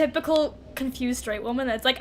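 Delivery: normal talking voice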